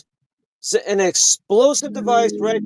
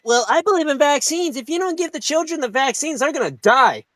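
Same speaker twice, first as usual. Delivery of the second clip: higher mocking voice of a preachy aunt; mocking the liberal aunt's warning in a higher put-on voice; 6 semitones higher than usual. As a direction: lampoon the aunt, pitched well up. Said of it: "the aunt".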